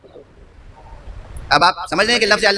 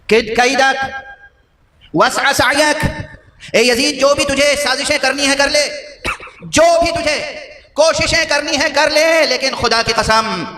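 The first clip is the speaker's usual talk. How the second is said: high, impassioned challenge, stretched out toward the end; Sayyida Zainab's defiance; 8 semitones higher, slower than usual. Retold or imitated. Captive woman addressing the tyrant in his court, defiant and high. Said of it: "جب یزید سے خطاب کیا ہے".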